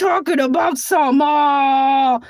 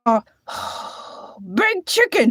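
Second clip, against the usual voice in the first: ordinary talk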